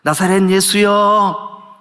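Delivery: drawn-out plea called to someone passing; imitating a beggar's insistent cry; acted crying out. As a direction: call out the names with long, stretched endings. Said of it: "막 소리를 질러요".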